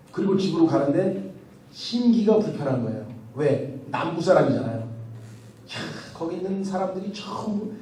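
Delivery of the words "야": ordinary talk, nothing marked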